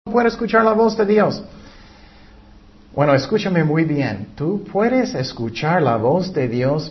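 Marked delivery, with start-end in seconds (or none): 0.1-1.4 higher, whiny complaint; a light mock whine about not hearing God; complaining believer. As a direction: mimic someone who says they can't hear God. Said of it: "muchas personas dicen".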